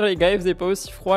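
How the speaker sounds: normal talking voice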